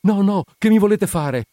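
high, loud cry of alarm; frightened. A narrator's reading. Wounded woman trying to rise from the surgeon, frightened cry. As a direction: plead in panic, pitch leaping up. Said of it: "sgranò gli occhi spaventata".

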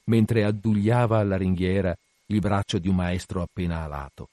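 ordinary delivery, nothing marked

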